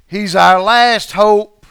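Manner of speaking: slow, loud desperate plea; cling to the last hope. Acted. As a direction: fishermen pleading in the storm. Disciples' desperate voice.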